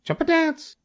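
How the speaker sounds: extremely high rooster squawk. A narrator's reading. The rooster joins in advising Wilbur, high crowing. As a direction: crow it out at the top of the voice.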